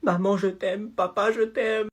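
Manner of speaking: soft, whimpered goodbye; hushed, resigned declaration of love; frightened farewell. Acted. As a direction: murmur last words to the parents. Last farewell while hiding.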